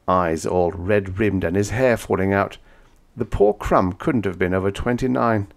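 plain reading voice

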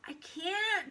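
high-pitched anxious refusal; protesting boy. A narrator's reading. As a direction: protest to your tempter. Paul resists the pigtail.

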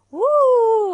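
long, high, loud "woo"; real celebratory holler; excited whoop. Real, not acted. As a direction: let out a big sustained whoop. Cheering viewer at end of session.

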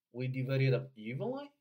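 "we divided up evenly" loud and linked, rising on a stressed "evenly"; model for repetition. Shown as a demonstration. Coach leading the second repeat, linked model reading. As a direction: loudly link "we divided up", then stress "evenly". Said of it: "请跟读三遍".

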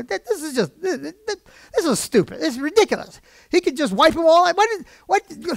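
high, sputtering retold rant; worked-up exasperation. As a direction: act out losing patience, stammering and climbing in pitch. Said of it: "I can get pretty worked up".

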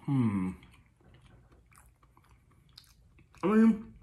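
muffled closed-mouth hum; speaking between chews; mouth full, chewing. Real, not acted. Seen 3: bite taken at -9; closed lips shift, looking down, chewing.

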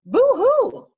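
acted owl hoot of alarm; frightened owl hoot. Owl takes one look at the Gruffalo, frightened hoot.

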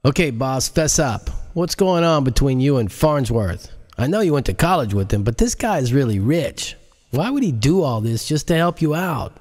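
pushy friend. Acted. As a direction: needle the boss for answers. Character voice in read-along.

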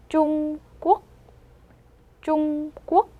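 teacher's slow model of "쫑꼬"; demonstrating "쫑꼬". Shown as a demonstration. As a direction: hold "쫑" level, then a short "꼬". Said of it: "이렇게 되겠죠".

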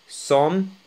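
"سوم" ending on m, no s; silent final letters. Shown as a demonstration. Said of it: "حتى الإس لم تنطق".

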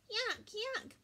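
put-on high-pitched toddler disgust; baby crying out. A narrator's reading. Baby Louie reacts to Marley's licking, high crying out. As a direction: squeal it like a disgusted little child. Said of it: "Baby Louie cried".

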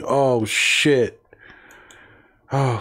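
ordinary talk, nothing marked

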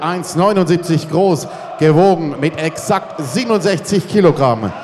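ordinary talk, nothing marked

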